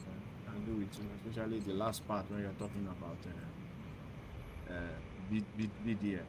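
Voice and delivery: faint, distant voice on a weak line; speaking very low, hard to hear; barely audible. Real, not acted. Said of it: "Is your voice is very low".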